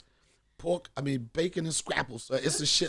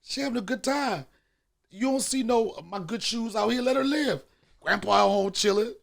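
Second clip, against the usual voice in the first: raised, higher pleading voice; 6 semitones higher, 4 dB louder than usual. Retold or imitated. Comedian defending grandma to Holly, raised pleading. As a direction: beg them to let her be. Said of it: "I'm like".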